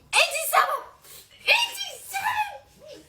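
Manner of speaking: very high squealed accusation; mock blaming. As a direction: squeal the blame, drawing it out the second time. Squealed mock blame at child. (صريخ رفيع باتهام تمثيلي)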